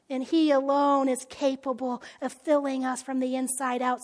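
ordinary talk

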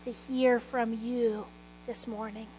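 soft, hushed opening prayer. Acted. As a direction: pray quietly and earnestly to God. Opening prayer before the sermon.

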